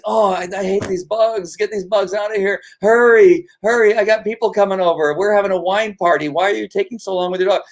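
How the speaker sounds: high-pitched fussy, impatient host; put-on high whine, hurrying them along; funny voice. Shown as a demonstration. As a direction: pester the handler in a silly high voice. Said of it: "sometimes I do it in a funny voice".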